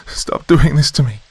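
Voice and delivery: high, loud cry of pain and terror; anguished cry. A narrator's reading. Girl begs the creature inside her to stop, high anguished cry. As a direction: scream it out as your bones break. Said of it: "I cried".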